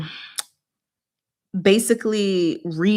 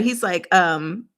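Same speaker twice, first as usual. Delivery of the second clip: usual speaking voice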